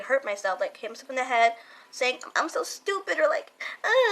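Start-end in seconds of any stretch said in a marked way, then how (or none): 2.4-3.2 upset self-blame. Retold or imitated. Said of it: "I would get super upset and cry". 3.8-4.1 high-pitched frustrated groan, imitated; upset groan. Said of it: "like, I will get upset".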